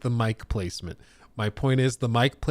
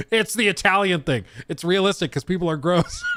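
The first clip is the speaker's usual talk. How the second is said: mocking chat; 6 semitones higher than usual. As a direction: mimic the chat's know-it-all excuse. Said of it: "People are like".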